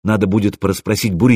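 plain reading voice

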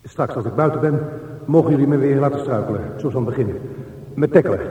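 loud, rising defiance hurled at the dark; loud and unyielding; loud defiant speech. Acted. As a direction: shout your terms at the tunnel.

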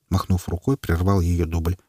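usual speaking voice

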